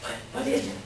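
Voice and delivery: ordinary talk, nothing marked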